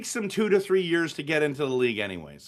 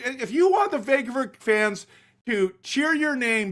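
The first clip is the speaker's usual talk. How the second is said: mock pep rant; 6 semitones higher than usual. Rant voicing coach to player.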